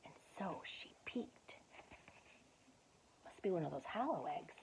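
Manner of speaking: hushed storyteller whisper. A narrator's reading. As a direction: breathe it like a secret. Whispered aside while reading.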